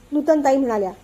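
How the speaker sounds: usual speaking voice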